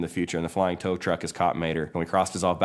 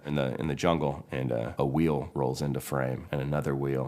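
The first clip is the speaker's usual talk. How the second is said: usual speaking voice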